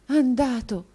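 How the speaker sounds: put-on boy hears his friend may leave, startled exclamation; exclaiming. A narrator's reading. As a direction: blurt it out in shock. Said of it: "esclamò l'altro".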